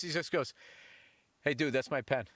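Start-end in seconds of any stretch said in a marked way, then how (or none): 1.4-2.3 lower, blunt ask for the pen back; flat demand. Retold or imitated. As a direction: drop the act, just want the pen. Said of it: "the same voice".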